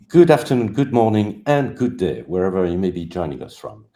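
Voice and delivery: big broadcast hello; announcer greeting. Acted. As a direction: boom it out like a radio host opening the show. Host's grand show opening greeting.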